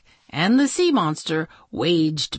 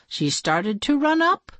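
ordinary delivery

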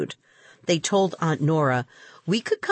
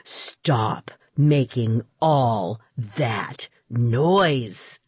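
lower, very slow booming bellow; deep bellow; 6 semitones lower, much slower than usual. A narrator's reading. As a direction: boom it over the fence like an angry neighbor. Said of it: "a deep voice bellowed".

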